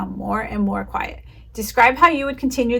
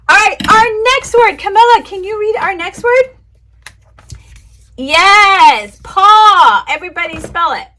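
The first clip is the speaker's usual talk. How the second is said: bright, high, loud kindergarten teacher voice; teacher to young kids; 4 semitones higher, 5 dB louder than usual. Shown as a demonstration. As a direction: greet the little ones with big cheer, higher and louder. Teaching demo to imaginary class.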